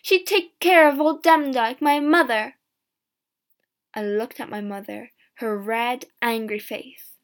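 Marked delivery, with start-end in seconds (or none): 0.0-2.6 loud, raised-pitch shout. A narrator's reading. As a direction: shout a protest from the doorway. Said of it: "my mother shouted from the door".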